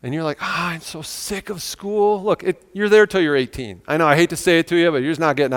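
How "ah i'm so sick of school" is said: slow, soft weary groan of complaint; fed-up student. Acted. Preacher voicing students sick of school, fed up and dragging. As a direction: drag it out like a fed-up pupil.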